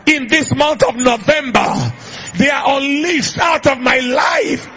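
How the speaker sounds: high, loud preaching voice; preaching at full force. Acted. Preacher prophesying over the congregation, full force. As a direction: preach it out with fire.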